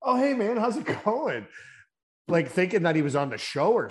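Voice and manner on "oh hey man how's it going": mock surprised greeting. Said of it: "And I'm like".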